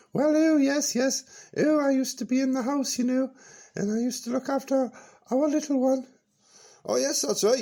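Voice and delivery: put-on high, bright-eyed old dear reminiscing; high character voice Peachy. Old character reminiscing to the radio host, high voice.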